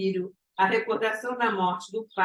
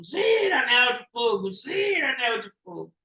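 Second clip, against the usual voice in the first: mock bellow with a drawn swoop up; acted bellowing; 3 semitones higher than usual. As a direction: call out like a raving madman, pitch leaping then falling. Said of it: "girando em círculos e berrando".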